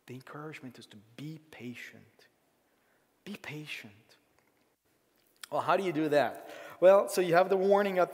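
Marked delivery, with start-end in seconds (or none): 0.1-3.9 hushed whisper, almost secret. Acted. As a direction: lean in and breathe the words. Pastor hushes key verse.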